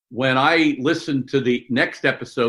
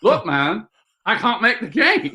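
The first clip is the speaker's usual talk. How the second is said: put-on British accent, pitched up and loose; jokey British accent impression; mock British accent; 8 semitones higher than usual. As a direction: play Mark in a hammed-up British accent. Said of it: "No, I'm doing British now".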